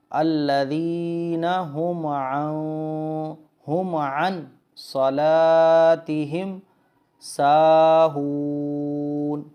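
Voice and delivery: measured tajweed recitation for learners; Quran recitation. Shown as a demonstration. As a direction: recite it clearly with held long vowels. Slow Quran recitation demo.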